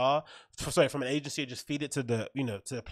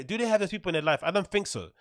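normal talking voice

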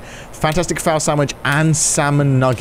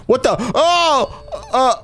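startled; 9 semitones higher, much slower than usual. Real, not acted. Startled by creepy cow.

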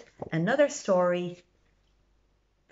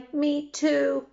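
small creature voice; 8 semitones higher than usual. Acted. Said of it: "says a turtle".

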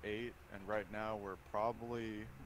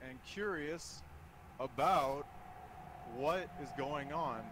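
nervous breakdown; 4 semitones higher, 9 dB louder than usual. Real, not acted. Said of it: "This is me talking right now".

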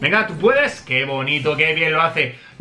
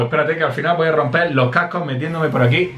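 ordinary delivery

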